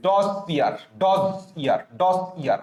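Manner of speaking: ডজ ইয়ার as two beats, "doss, iyar"; broken-up drill. Shown as a demonstration. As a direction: split ডজ from ইয়ার, evenly repeated. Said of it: "ভেঙে লিখতে হবে".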